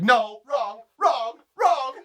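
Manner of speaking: high, rapid-fire scolding; indignant correction. Acted. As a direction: cut them off in comic outrage. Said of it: "I'd be like".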